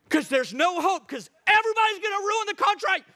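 high, loud hammed-up doom; mock-hopeless outcry; mock despair. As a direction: wail it in exaggerated alarm, rising high. Mocking everyone's doom about the country.

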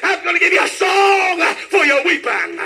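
driving, shouted revival cadence; full-force preaching. Real, not acted. Preacher promising Hannah a song for weeping, shouted preaching. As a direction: hammer out each phrase, stretching its last word.

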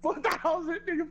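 usual speaking voice

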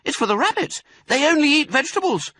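put-on very high, loud child voice; earnest fox cub. Smallest fox explains carrots to Dad, earnestly.

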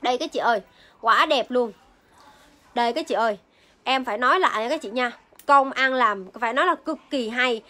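ordinary talk

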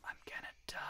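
hushed whispered dread. Real, not acted. Streamer to chat while losing, whispered. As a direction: breathe it out, barely voiced.

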